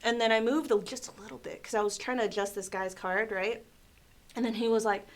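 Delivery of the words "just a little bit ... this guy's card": ordinary delivery, nothing marked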